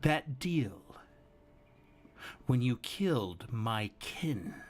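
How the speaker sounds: slow villain with long, deliberate pauses; composed menace, each phrase left hanging; calm, collected villain. Acted. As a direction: spell out the broken deal unhurriedly, in measured fragments. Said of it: "calmly, collectively, he goes".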